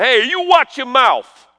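high, drawn-out New York scolding; hammed-up warning to a cheeky kid; mock scolding elder. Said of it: "I hear 'em say".

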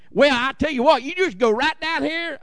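imitated Tennessee country accent, pitched high; high-voiced impression of a country-accented Tennessee girl; thick country accent. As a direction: lay on a high Southern country twang. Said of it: "She was so country, ... such an accent".

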